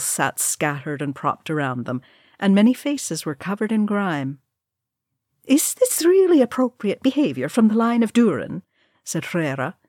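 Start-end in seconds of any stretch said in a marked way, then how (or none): 5.4-8.6 haughty matron. Acted. Haughty dwarf matron objecting.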